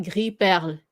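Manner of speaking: Gris perle with silent S and rolled French R; French Gris perle, light final E; careful tongue-twister demo. Shown as a demonstration. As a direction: say Gris perle as "gree pairl". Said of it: "it's a tongue twister".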